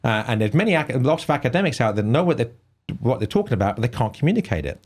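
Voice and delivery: ordinary talk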